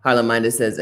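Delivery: ordinary delivery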